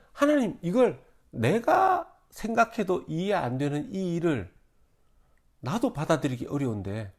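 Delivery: high, rising plea to God; pleading complaint to God; Moses complaining. Acted. Preacher voices Moses' complaint.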